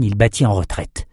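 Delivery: usual speaking voice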